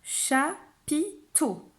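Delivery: slow "Chapiteau" in three separate beats, cha, pi, teau; syllable by syllable. Shown as a demonstration. Teacher pointing at each syllable box, word split up. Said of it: "Tu vas compter les syllabes de chapiteau".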